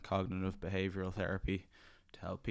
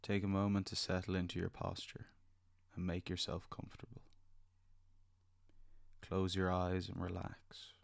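soft spa whisper; 4 dB quieter than usual. Acted. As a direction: gently lead them into calm. Leads a guided meditation.

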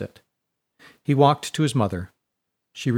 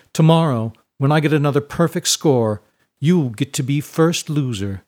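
raised, smug taunt; gloating bully; 4 semitones higher, 5 dB louder than usual. A narrator's reading. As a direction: rub the coming victory in. Bully gloats in rival's face.

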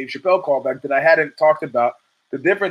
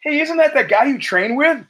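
higher, imitating the wife's eager question; mock wife voice; 11 semitones higher than usual. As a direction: ape the wife pointing him out. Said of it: "And she goes".

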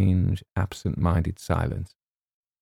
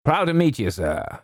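lightly voiced Colonel; a hint of a hearty military greeting; higher-pitched opening in a light Colonel voice; 9 semitones higher than usual. A narrator's reading.

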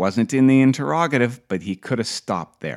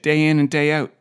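ordinary delivery

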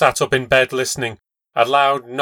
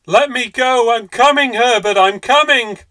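high, loud desperate call; 11 semitones higher, 7 dB louder than usual. Acted. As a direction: call out to her son. Mother struggles toward her son.

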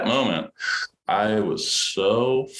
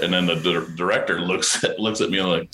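usual speaking voice

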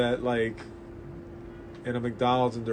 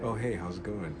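low, soft, mellow greeting; smooth jazzy; 7 semitones lower, 11 dB quieter than usual. Retold or imitated. Comic shop clerk greets a customer, smooth and jazzy. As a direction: talk like a hushed jazz DJ. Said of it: "he talked very smooth jazzy".

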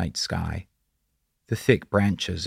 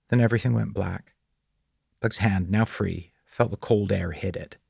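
ordinary delivery

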